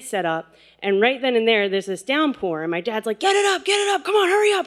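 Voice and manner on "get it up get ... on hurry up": high-pitched, urgent retold shout; yelling orders. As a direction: imitate a dad frantically hurrying the kids. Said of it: "yelling orders at us".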